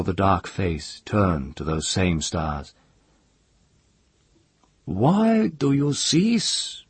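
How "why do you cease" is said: high, slow, puzzled chieftain; chief's voice. A narrator's reading. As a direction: ask slowly in a high commanding voice. Chief questions the singer.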